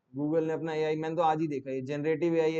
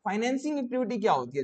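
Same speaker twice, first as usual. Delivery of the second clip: ordinary talk, nothing marked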